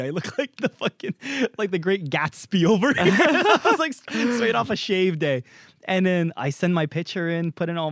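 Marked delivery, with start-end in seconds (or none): 0.0-5.4 higher voice broken by laughter; real laughter; laughing while talking. Real, not acted. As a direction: talk through the giggles at your goofy photo. Laughing at his photo.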